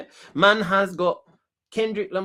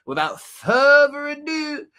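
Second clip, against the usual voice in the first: drawn-out announcer intro; 10 semitones higher, 6 dB louder, slower than usual. Acted.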